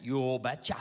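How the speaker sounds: low Minnesotan Norwegian father; thick Norwegian accent. Retold or imitated. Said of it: "in his thick Norwegian accent".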